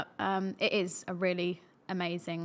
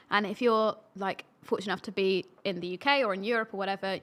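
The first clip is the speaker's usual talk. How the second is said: ordinary talk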